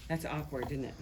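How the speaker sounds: usual speaking voice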